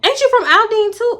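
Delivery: high-pitched nosy question; mock friend. Friend asking where she is from, high mocking voice. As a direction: imitate her asking, pitched right up.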